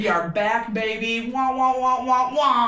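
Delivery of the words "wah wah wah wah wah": loud trumpet-like wah wahs; muffled wah wah talk. Pretend. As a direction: play a muted trumpet with your voice. Playful intro noises.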